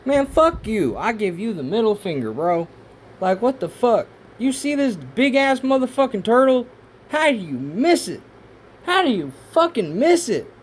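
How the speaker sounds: high, loud incredulous ranting; exasperated rant. Real, not acted. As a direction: rail at the experts who missed it. Ranting at geologists.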